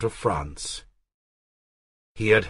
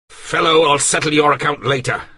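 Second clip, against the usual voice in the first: louder, quick menacing dismissal; threatening; 7 semitones higher, 6 dB louder, faster than usual. Acted. Artois threatens the servant, mild menace. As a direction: snap the threat and move on.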